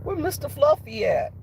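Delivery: put-on high childlike calling; kid calling. Little kid searching the yard for her chicken, high and sweet.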